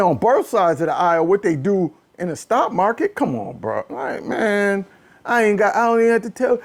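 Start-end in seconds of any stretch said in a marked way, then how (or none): none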